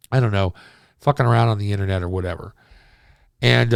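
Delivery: usual speaking voice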